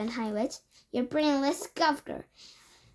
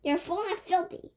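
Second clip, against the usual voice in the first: high chorus echo; 8 semitones higher than usual. A narrator's reading. English witches echoing their leader, high chorus. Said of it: "chorused the English witches".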